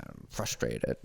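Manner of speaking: put-on low, muffled kid mumble of frustrated; sulky child mumbling frustrated; imitating son, mumbled. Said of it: "He said".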